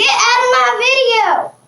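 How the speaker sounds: shouting at a fly. Real, not acted. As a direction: shout it at the bug. Yelling at a fly.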